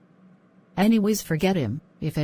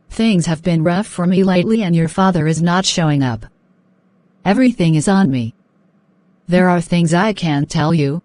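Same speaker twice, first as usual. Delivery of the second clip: loud apology; 8 dB louder than usual. Acted. Mother apologizes, still loud.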